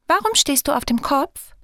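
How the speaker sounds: high, curious fairy question. A narrator's reading. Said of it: "fragt sie neugierig".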